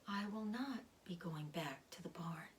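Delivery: barely audible, hushed whisper; very low whisper. A narrator's reading. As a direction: whisper so he can hardly hear. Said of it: "spoke in a voice so low".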